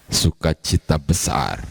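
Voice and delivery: slow, weighty statement of great joy; slow emphasis. Shown as a demonstration. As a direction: lay each word down slowly. Repeated phrase, first take.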